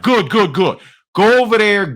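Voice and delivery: imitated New Jersey boss, high and loud; punchy put-on New Jersey order; New Jersey accent. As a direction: send them off briskly in New Jersey style. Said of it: "he had a New Jersey accent".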